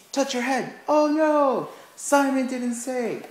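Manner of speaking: very high, loud oh no; spring the trap with big fake dismay; mock alarm. Shown as a demonstration. Teacher catching the class out, mock alarm. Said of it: "You could just be like".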